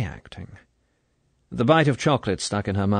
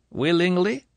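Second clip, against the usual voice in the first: probing colonel; 9 semitones higher than usual. A narrator's reading. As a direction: press the point.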